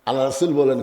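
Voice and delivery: normal talking voice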